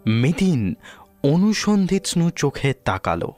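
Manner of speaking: plain reading voice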